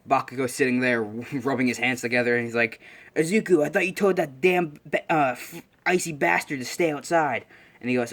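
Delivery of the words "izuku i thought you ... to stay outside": higher-pitched mock grumbling; lightly acted annoyed outburst; irritated complaint. Bakugo rubbing cold hands, scolding Izuku, irritated.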